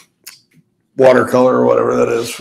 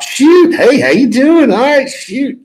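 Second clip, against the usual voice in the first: much higher, over-the-top Spurrier; cartoonish Spurrier; 12 semitones higher, slower than usual. Copying a friend's cartoon Spurrier, much higher. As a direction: squeak it out like a cartoon coach. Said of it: "impersonate James Bates's impersonation".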